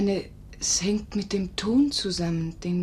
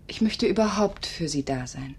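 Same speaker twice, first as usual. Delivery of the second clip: ordinary talk, nothing marked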